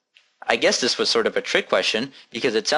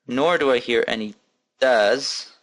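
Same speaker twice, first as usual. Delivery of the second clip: ordinary talk, nothing marked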